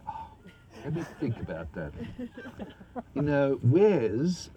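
slow, drawn-out camp preening for the camera; prissy smart-ass. Retold or imitated. To a Japanese film crew, playing the prissy smart-ass. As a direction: ham it up like a prissy show-off. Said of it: "I'm prissing up and down the boardwalk".

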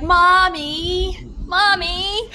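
whiny high cat calling mommy. Retold or imitated. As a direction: play the cat whining for mommy. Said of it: "this whole thing, like".

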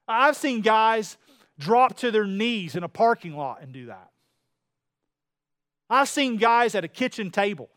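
loud, fervent testimony of lives changed; full-force preaching. Acted.

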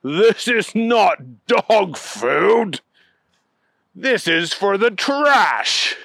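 incredulous, drawn-out dismissal; scoffing. A narrator's reading. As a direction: scoff at it, stretching each word. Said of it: "Neville laughed loudly".

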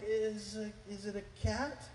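high, soft, tentative question; hesitant guess. Retold or imitated. Dad guessing what his child made, tentative. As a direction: imitate a dad guessing unsurely.

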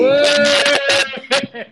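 high, drawn-out "ué" whoop; long whooping cry. Real, not acted. Friend reacts to a revelation, high drawn-out whoop. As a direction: let out a high held whoop.

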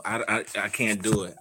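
normal talking voice

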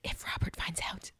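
hushed, unvoiced dying secret; soft imitated whisper. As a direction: whisper it as a barely audible confidence. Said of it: "she whispers something to Ned".

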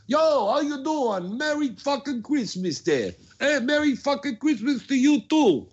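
high-pitched, hollered holiday greeting; yelling out the window. Acted. Host imitating back-east neighbors yelling out windows, high-pitched holler. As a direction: holler it like a neighbor across the street. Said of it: "you just yell out the window".

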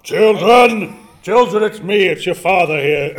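put-on loud, high call to the kids. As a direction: shout for the children from afar. Father arrives to take kids.

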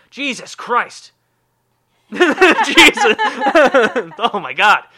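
high loud laughing "Jesus!"; loud stunned laughing yell; shocked outburst. Real, not acted. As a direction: explode in disbelief and laughter. Shocked by birth year 2000.

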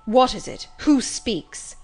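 loud, agitated query; urgent demand. A narrator's reading. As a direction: demand to know who is there. Blind man demands who speaks.

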